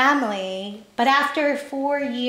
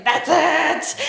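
loud, raised outburst; snapped; 6 semitones higher, 6 dB louder than usual. Retold or imitated. Waitress fed up with rude customer, snapped outburst. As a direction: let it burst out, high and loud. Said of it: "I just snapped".

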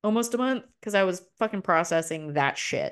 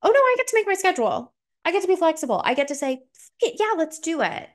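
gleeful freedom; 11 semitones higher than usual. Retold or imitated. To her old self about schedules, mock gleeful and high. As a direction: sing out the freedom to choose. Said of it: "and being like".

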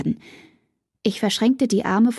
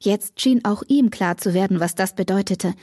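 ordinary delivery

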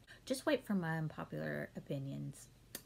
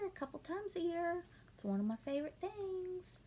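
excited gushing; 10 semitones higher than usual. Real, not acted. Gushing about the beach.

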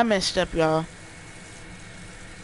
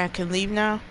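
ordinary talk

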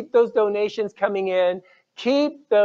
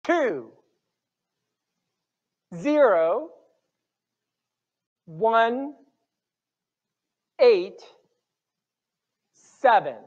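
raffle caller; much slower than usual. Acted. Calls out raffle numbers.

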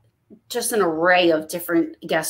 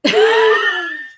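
high burst of helpless laughter; high-pitched real laugh; 12 semitones higher, 3 dB louder than usual. Real, not acted. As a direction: crack up at the joke. Cracking up at the demon joke.

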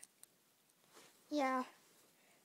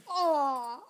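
pained groan; 8 dB louder than usual. Pretend. Pet getting beaten.

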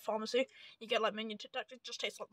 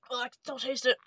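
after bitter medicine; 6 dB louder, faster than usual. Real, not acted. Refusing the lingering taste.

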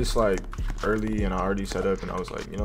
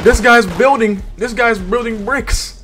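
excited; 12 semitones higher, 9 dB louder than usual. Real, not acted. Excited reaction to enemy building.

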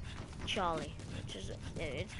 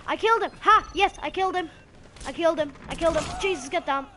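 loud, high, excited crowing; triumphant shouting; 8 semitones higher, 11 dB louder, faster than usual. Real, not acted. Gamer celebrating a kill, loud triumphant shouting. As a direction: crow it out at full voice.

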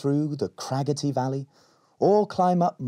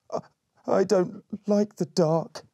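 timid and slow; 9 semitones higher than usual. Acted. Scared Berry in forest.

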